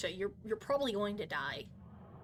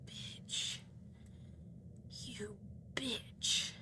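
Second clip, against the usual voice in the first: breathy whisper, no voice; whispered you bitch; whispered insult; 13 dB quieter, much slower than usual. Real, not acted. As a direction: hiss it under your breath. Muttered insult at another player.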